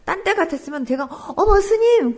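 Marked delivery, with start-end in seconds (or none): 1.4-2.2 high, loud startled greeting to the monk; a sudden pleased gasp at the monk; surprised exclaim. Retold or imitated. Imagining greeting the big monks at church, high surprised cry. What she'd normally exclaim.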